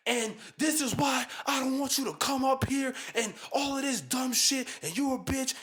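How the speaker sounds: loud, high furious shout; angry yelling. Retold or imitated. Daughter yelling at her family in the room, loud and furious. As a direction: scream it at the whole family. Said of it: "all you hear is".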